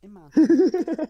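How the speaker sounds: loud, high, slow taunt; mocking. Real, not acted. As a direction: draw out the mockery. Mocking George's cheating.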